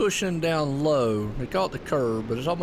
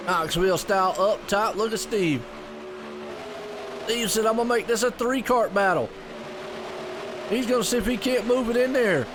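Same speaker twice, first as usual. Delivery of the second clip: excited, higher live race call; race play-by-play; 4 semitones higher than usual. Acted. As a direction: call the race lap by lap with energy.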